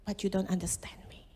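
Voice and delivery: hushed, sad whisper; whispered sorrow. Acted. God grieving that the servant misunderstood, whispered. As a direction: let the disappointment fall to a whisper.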